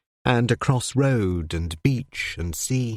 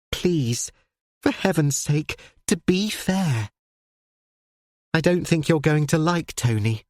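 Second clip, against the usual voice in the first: put-on eager girl, pitched higher; earnest daughter explaining to her father; young daughter's voice; 5 semitones higher than usual.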